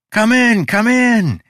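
high, loud eager urging; insistent welcome. A narrator's reading. Host waving the visitor over, insisting eagerly. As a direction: urge them in, brooking no delay. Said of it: "he insisted".

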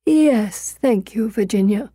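put-on weak, distant old man; frail old father. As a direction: answer faintly, lost in thought. Weak old father answers daughter.

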